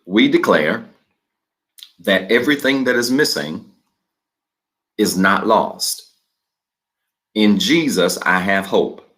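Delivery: slow, measured reading with long pauses between phrases; slow declaration reading. Shown as a demonstration. Pastor leading the online congregation through the declaration, slow and measured. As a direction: read each phrase slowly and leave room for the congregation to repeat. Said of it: "I'm gonna read it slow as I always do".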